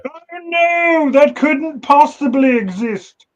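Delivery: put-on very high, posh-sounding English accent, slow on the second line; high, slow English accent scoffing at a new species; mock English accent. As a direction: scoff like a stuffy English scientist. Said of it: "do your English accent on that one".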